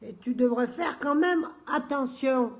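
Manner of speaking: slow, high, loud put-on formality; mock-solemn letter. Answering Lutzi's message as a joke, mock-solemn. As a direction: declaim it slowly, as a joke warning. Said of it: "Non, je déconne".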